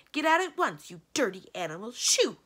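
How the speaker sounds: loud, high outburst shooing them away; furious. A narrator's reading. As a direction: order them out with real fury, high and loud. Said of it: "turned around furiously and said".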